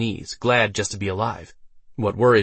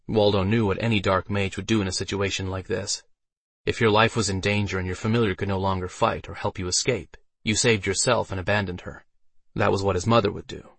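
plain reading voice

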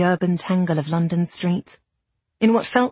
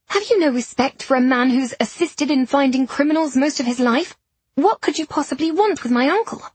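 higher, indignant confrontation; indignant outrage; 6 semitones higher than usual. A narrator's reading. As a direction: shame them with outrage. She confronts the police.

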